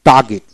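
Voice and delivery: টার্গেট as "taa-git", R silent; phonetic dropped R. Shown as a demonstration. Teacher repeats phonetic form for learners, টার্গেট without R. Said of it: "ফোনেটিক্সে উচ্চারণ হবে".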